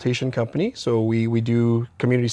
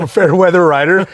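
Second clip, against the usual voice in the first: laughing it out, pitch up and louder; 7 semitones higher, 8 dB louder than usual. Real, not acted. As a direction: chuckle through the words at yourself. Self-deprecating laugh at himself.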